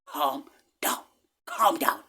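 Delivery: hushed whisper, repeated; an inner voice whispering calm. Retold or imitated. An inner voice whispers to the panicking passenger to stay calm. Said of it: "but something said, like".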